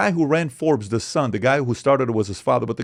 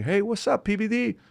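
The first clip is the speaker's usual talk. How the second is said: a light, higher imitation of a friendly caller; imitating Robert Downey; 10 semitones higher than usual. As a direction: greet him breezily as a movie star would. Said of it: "a call with Robert Downey Junior".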